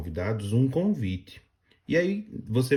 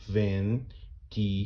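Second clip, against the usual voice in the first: "ventilador" split slowly: ven-ti-la-dor, each syllable apart; syllables spelled out; 3 dB quieter, much slower than usual. Shown as a demonstration. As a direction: count out "ventilador" slowly, one beat per syllable. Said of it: "você vai contar comigo". ("ventilador" separado devagar em sílabas: ven-ti-la-dor)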